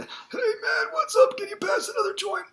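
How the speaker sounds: high, pressed, held-back voice; squeezed high stoner voice; glottal compression demo. Shown as a demonstration. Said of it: "let me show you what I mean".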